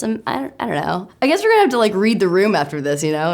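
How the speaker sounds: ordinary delivery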